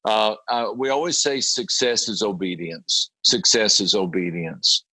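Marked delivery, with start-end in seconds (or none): none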